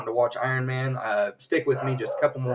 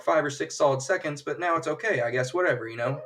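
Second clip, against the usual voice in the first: normal talking voice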